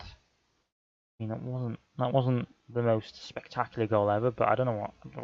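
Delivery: ordinary talk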